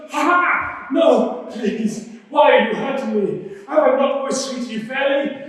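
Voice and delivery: high, loud mock protest; pleading donkey. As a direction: plead as a wronged talking donkey.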